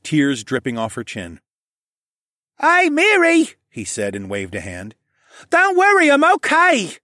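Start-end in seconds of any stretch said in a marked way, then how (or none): 2.6-3.5 put-on very high, louder kid voice; boy's voice. A narrator's reading. Stable boy greeting the crying girl, high kid voice. 5.5-7.0 put-on very high, louder kid reassuring; reassuring boy. A narrator's reading. Stable boy reassuring the crying girl, high kid voice.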